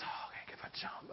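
hushed unvoiced whisper of a nervous child. Daughter talking herself into jumping, whispered. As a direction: whisper it to yourself, worried. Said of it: "She says".

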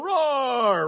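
loud drawn-out mock roar; put-on bear bellow. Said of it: "That's my best bear impersonation".

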